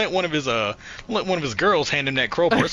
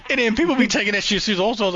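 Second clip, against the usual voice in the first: excited rant; 7 semitones higher, faster than usual. Real, not acted. Mock outrage at debaters.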